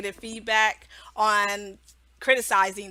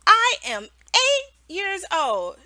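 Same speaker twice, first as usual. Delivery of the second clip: a hint of a child, higher, slow; lightly voiced child; 8 semitones higher, 4 dB louder, slower than usual. Mock student giving the wrong answer, lightly childlike. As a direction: answer off-topic like a kid. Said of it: "And they say".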